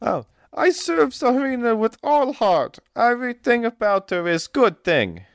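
high, dramatic voice with broken English; fretful noblewoman. Acted. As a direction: wring your hands over the poisoning. GM voices Olga.

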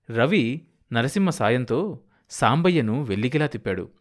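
ordinary delivery

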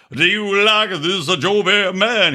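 lightly voiced slow [name] officiant; a hint of an [name] drawl, slow; [name] impression. Officiant at a Vegas wedding, faintly [name]. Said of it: "talk like [name]".

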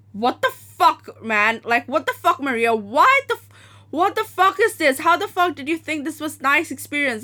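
high, loud disbelieving yell at her friend; incredulous shout. Retold or imitated. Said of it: "She was like".

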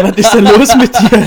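loud laughing question. Real, not acted. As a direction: burst out laughing while asking. Lacht über Antwort des Freundes.

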